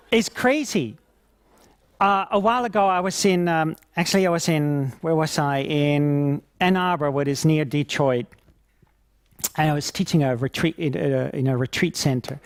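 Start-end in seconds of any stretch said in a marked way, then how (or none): none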